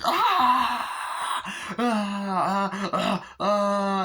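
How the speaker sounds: high, loud, crying yelps of pain; mock pained screams. Reviewer acts a man being stapled, crying screams. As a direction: yelp like you're being stapled. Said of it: "starts getting stapled in the crotch".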